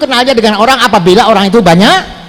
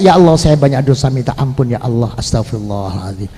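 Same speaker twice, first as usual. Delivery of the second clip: low, penitent plea growing softer; penuh rasa; 8 semitones lower, 4 dB quieter than usual. Shown as a demonstration. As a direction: beg forgiveness humbly, sinking to a murmur. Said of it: "harus saya punya rasa". (memohon ampun dengan rendah dan makin lirih)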